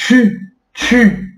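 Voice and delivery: tu said through a small puff, lips in a tube; French tu with rounded lips. Shown as a demonstration. Teacher showing the French u in tu. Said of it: "faz um tubinho assim com a boca".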